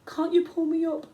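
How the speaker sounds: high, loud desperate plea; begging. A narrator's reading. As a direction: beg to be pulled up. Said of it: "I begged".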